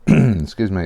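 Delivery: normal talking voice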